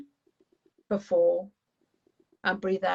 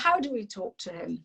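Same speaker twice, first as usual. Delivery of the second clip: usual speaking voice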